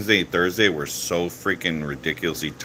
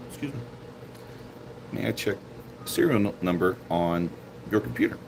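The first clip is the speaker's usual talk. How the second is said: softer, polite request; polite soft ask; 3 semitones higher, 5 dB quieter than usual. Retold or imitated. To the social worker on the phone, soft and polite. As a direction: ask the social worker gently, barely above a murmur. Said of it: "Just like this, that's how it was".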